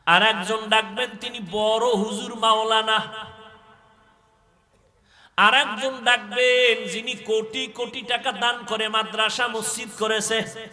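loud, high sermon chant; impassioned preaching. Acted. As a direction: preach at full force, stretching the line endings. Preaches at full force.

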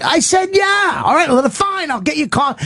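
very high-pitched, hammed-up indignation; acted exasperated yell. Pilots bicker about getting coffee, exasperated. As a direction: squeal it like a fed-up pilot in a squabble. Acted cockpit quarrel escalates.

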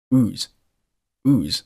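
"ooze" for whose, starting straight on the vowel with no h; "ooze" with silent h. Shown as a demonstration. Teacher shows learners the weak form, "ooze" without an h. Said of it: "不发音".

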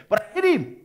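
high, loud exclamation; incredulous outburst. Retold or imitated. Boy who never had to fight admits the punch, incredulous. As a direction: burst out high, amazed at yourself.

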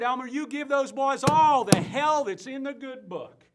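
high, loud evangelist's urging; impassioned preacher's quote, building in force; rousing exhortation. Acted. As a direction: belt it out as a rallying command. Quotes Sister Sharon urging him on.

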